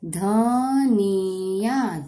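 धनिया stretched out very slowly; sounding out slowly. Shown as a demonstration. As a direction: draw out धनिया syllable by syllable.

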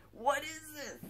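high, soft, puzzled outburst; bewildered, high and hushed; baffled. Real, not acted. Baffled by an unknown item.

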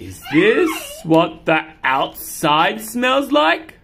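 slow, drawn-out wonder; slow amazed cat. Acted. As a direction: marvel at the smell slowly. Cat wonders about outside.

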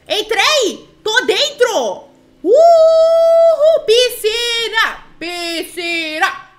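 loud, high whoops with drawn-out words; excited cheering. Pretend. Player announces she is in and heads for the pool, cheering. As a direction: celebrate at full voice, stretching the vowels. Celebra entrada, corre pra piscina.

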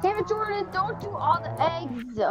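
exasperated yell. Real, not acted. Friend grabbing all the eggs.

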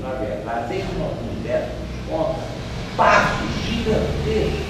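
usual speaking voice

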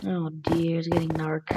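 scared whisper; hushed, nervous whisper. Real, not acted. Night falling, scared whisper.

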